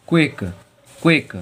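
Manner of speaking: क्विक said crisply as "kwik". Shown as a demonstration. Teacher drills क्विक for the class. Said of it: "Q के बाद फिर यू आया".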